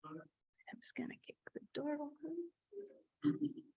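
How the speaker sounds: slow breathy whisper, joking; whispered aside. Real, not acted. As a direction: whisper it slowly like a secret. Joking aside during tech trouble.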